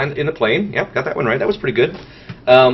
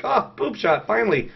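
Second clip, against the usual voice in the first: mock eager; 6 semitones higher than usual. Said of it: "I was like".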